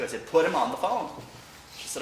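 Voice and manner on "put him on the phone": louder, pressing "Put him on the phone"; insisting louder. Retold or imitated. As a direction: repeat it, overriding her doubt. Friend hesitates, he insists.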